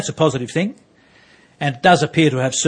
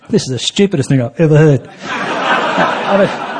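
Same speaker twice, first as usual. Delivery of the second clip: laughing; 5 dB louder, slower than usual. Real, not acted. Laughing at the absurd remark.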